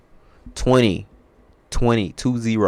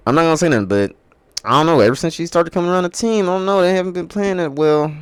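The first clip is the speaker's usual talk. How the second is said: defensive hedging; 9 semitones higher, 5 dB louder than usual. Real, not acted. Defensive hedging about blaming her.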